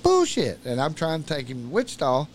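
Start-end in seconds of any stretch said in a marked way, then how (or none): 0.0-0.6 high, loud retold objection; sharp high-pitched protest; emphatic disbelief. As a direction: shoot the idea down, voice jumping way up. Acted refusal in retold argument.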